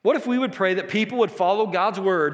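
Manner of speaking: ordinary delivery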